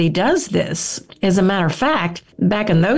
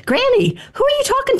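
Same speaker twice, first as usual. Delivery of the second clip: put-on much higher, curious small child asking granny; little girl voice. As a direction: pitch way up like a wondering little girl. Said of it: "I went up to her one day and I said".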